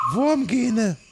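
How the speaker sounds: deutlich höhere, verstellte Forscherinnenstimme; erklärt beschwichtigend als Wissenschaftlerin; hohe Figurenstimme. Acted. Doktor Ye antwortet. (hohe verstellte Stimme, erklärend)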